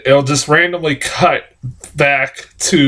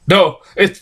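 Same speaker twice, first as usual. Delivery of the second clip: ordinary talk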